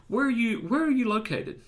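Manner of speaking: curious friendly. Retold or imitated. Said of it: "I gave him".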